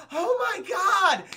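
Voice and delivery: high, slow gasp of shock; a hammed-up horrified gasp; mock gasp of horror. Halle Berry sees faces rotting, horrified gasp. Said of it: "She's like".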